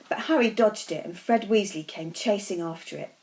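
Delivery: plain reading voice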